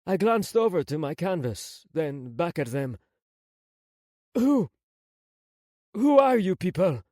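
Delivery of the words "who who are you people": raised, louder questioning; mildly alarmed, demanding an answer; bewildered demand. Acted. Prince to unknown rescuers, bewildered demand.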